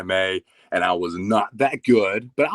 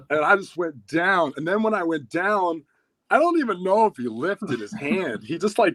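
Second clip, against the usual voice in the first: usual speaking voice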